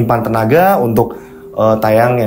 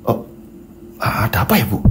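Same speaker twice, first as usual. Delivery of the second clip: dazed husband; 7 dB quieter than usual. Acted. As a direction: answer haltingly, as if the mind went blank. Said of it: "Bandi kayak berasa linglung".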